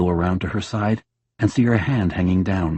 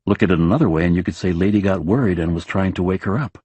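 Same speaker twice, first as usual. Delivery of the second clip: usual speaking voice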